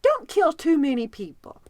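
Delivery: put-on little girl answering her Sunday school teacher, high and proud; little girl's answer. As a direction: pipe up proudly like a small child. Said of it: "She said".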